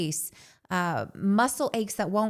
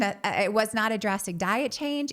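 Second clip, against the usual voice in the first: usual speaking voice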